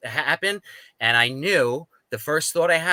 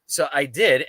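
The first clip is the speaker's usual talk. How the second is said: ordinary talk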